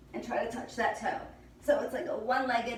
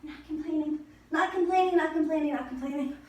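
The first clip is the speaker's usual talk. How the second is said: chanting it through the effort, louder; strained repeating; 5 semitones higher, 3 dB louder, faster than usual. Real, not acted. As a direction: grit it out with each rep. Coach repeating through the burn.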